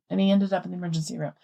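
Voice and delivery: ordinary talk, nothing marked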